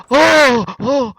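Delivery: high gasping "oh"; gasp, then let out high ohs. Pretend. Reacting to news of sleeping, high gasps.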